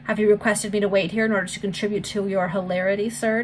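plain reading voice

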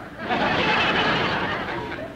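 high breathy giggle; a coy, airy giggle at his joke. Real, not acted. Coy laugh at TV doctor.

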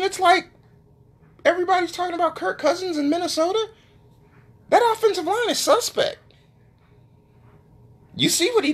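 high, impassioned rant; emotional, rising voice. Real, not acted. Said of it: "my voice is getting higher because I'm getting emotional".

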